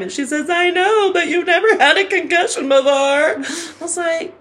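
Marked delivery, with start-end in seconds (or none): 0.5-2.9 high-pitched imitation of a fretting mother; mock motherly alarm; worried mom. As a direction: fret at your child, lightly hammed-up. Acting out her worried mother.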